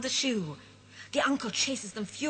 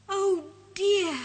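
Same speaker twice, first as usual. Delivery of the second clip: higher, worried moan; dismayed moan; 9 semitones higher, 6 dB louder than usual. Acted. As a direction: fret about getting home. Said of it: "he moans".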